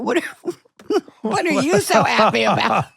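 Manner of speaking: high, laughing mock question; laughing high put-on voice; puzzled coworkers. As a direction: imitate the crew's amused surprise. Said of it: "And I went".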